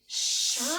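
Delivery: teacher models "Shout" for the class; sh word. Shown as a demonstration. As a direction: lean on the "sh" in "Shout". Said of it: "You can say it".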